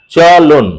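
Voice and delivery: "চলন" as a long "cho" then "lon"; syllable split. Shown as a demonstration. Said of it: "শব্দগুলো উচ্চারণ কিভাবে হচ্ছে".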